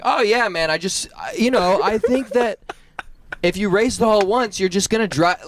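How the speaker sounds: high-pitched oblivious talker impression. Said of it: "let it rip full volume talking".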